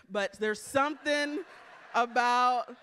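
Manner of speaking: usual speaking voice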